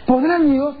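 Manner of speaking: ordinary delivery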